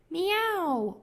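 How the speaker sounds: high meow that swoops down; cat meow. Retold or imitated. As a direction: imitate a barn cat meowing. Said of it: "went the gray barn cat".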